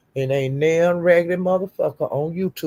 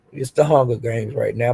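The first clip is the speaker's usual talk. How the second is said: ordinary delivery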